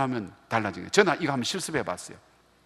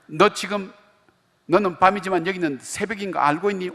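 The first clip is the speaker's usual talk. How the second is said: higher, sharp mock rebuke of a father; mock angry scolding; 7 semitones higher than usual. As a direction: pretend to snap at the daughter. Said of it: "열이 팍 내". (새벽 전화한 딸을 꾸짖는 아빠, 화난 척 다그치며)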